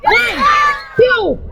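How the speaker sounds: high, shouted count to three; loud countdown. Real, not acted. Teacher shouting a countdown so the kids roar together. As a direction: call out the count to cue the roar. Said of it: "give me a roar".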